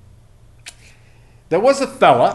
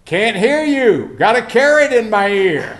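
loud oblivious reply; 5 semitones higher, 4 dB louder than usual. Retold or imitated. Carrot-eared man delivering punchline, high oblivious call. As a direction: call back as if hard of hearing.

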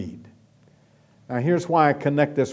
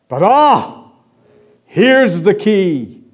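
louder, higher "ah", then a slow "here's the key"; building excitement; 5 semitones higher, 7 dB louder, much slower than usual. Acted. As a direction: savour the reveal. Preacher builds to key point.

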